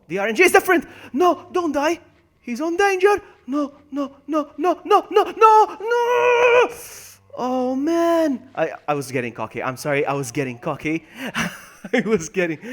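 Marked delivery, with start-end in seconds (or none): 0.1-8.5 high, loud frantic screams; panicked shouting. Real, not acted. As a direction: build from alarm to a wailed scream. Character dying in the game.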